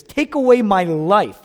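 loud, high, drawn-out plea; dramatic plea. Retold or imitated. Pastor quoting Jonah who wants to die, dramatic plea. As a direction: cry it out slowly and loudly. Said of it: "not just being dramatic here".

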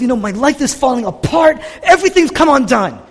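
high, loud outburst of despair; distraught complaint. Retold or imitated. Preacher as Jonah complaining to God, distraught and loud. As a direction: cry out as if everything is collapsing.

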